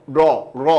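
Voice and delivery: র with a strong, rolled trill. Shown as a demonstration. Teacher showing students a heavily trilled র. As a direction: roll the র so the tongue tip beats hard. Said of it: "আপনি উচ্চারণ করে দেখুন".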